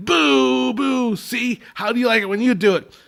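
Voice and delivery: high mocking villain boo back at the crowd; pissed-off Captain Hook. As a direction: taunt the crowd with their own boos, pitched high. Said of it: "he gets pissed off".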